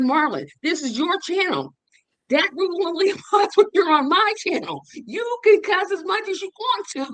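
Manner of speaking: high-pitched, emphatic mock protest; insistent retold protest. Host insisting to Marlon about whose channel it is, exasperated protest. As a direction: re-enact pleading with him, pitched well up.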